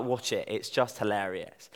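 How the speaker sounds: ordinary talk, nothing marked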